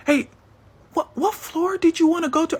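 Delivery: high, panicky coworker voice; a rattled worker suddenly rushing to help; a panicked employee asks which floor he wants; flustered coworker. Retold or imitated. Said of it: "Her coworker loses his shit".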